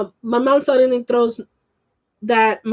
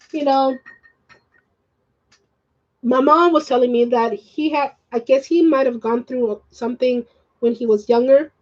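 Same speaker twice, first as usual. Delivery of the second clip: ordinary talk, nothing marked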